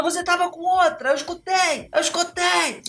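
high-pitched, insistent accusing cry; shrill accusation. Retold or imitated. Wife accusing husband of cheating, high and frantic. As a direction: hurl the accusation up high, hammering the repeat.

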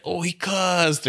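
mock children's drawn-out, high "ooh"; tattling kids. Kids reacting to a coach's swearing, mock tattling. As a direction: imitate scandalised kids, high and slow. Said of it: "all the kids were like".

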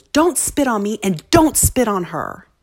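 loud, high, slow and emphatic; retold forceful command. Child standing up to the spitting boy, forceful. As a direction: lay down the law, punching each word. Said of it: "he said it with such force".

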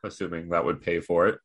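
ordinary talk

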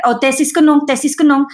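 a hint of Oma judging the dough; grandma's voice. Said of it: "I, like, hear her in the back of my head".

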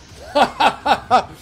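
high, loud mocking guffaw; giant's mocking laugh. Giantess bursting into scornful laughter, high and loud. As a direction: burst out laughing at the tiny gnome. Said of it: "she bursts into laughter".

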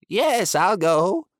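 lightly voiced shy beast; gentle creature agreeing. Pushmapulya agrees.

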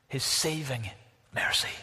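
hushed, whispered build-up; a reverent whisper before the key word. Real, not acted. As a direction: drop to a whisper for weight. Dramatic hush before key phrase.

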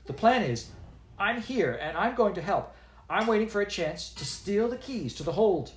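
a hint of an indignant apprentice; defensive young girl. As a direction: stand up for yourself. Voices Zinnia defending herself.

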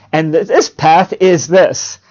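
loud, slow, emphatic point; demonstrating a deliberate, forceful announcement; attention-grabbing. Shown as a demonstration. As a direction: punch each word to wake up the room. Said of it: "you've caught their attention".

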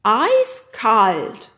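loud, high creature boast; proud yeti. A narrator's reading. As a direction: announce it proudly as a simple monster. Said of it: "nickte der Yeti stolz". (stolze, laute, hohe Yetistimme)

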